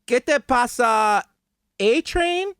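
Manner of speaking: clumsy beginner Spanish, drawn out and high; mock Spanish. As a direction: fumble a phrasebook Spanish line at a train. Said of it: "using Duolingo".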